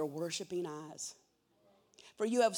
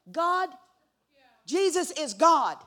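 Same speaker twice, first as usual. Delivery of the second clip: much louder and higher, pulpit-pounding; preaching shout; 8 semitones higher, 13 dB louder than usual. Acted. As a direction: proclaim it to the whole room.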